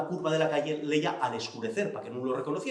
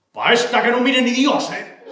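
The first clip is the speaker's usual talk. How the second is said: fanfarrón; 6 semitones higher, 9 dB louder than usual. Acted. Xico jura en el chigre.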